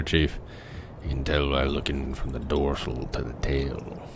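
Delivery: a grim shark hunter remembering the Indianapolis; Robert Shaw as Quint. Acted. Reciting the Jaws monologue as a haunted old sailor. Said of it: "Tell me if I captured Robert Shaw".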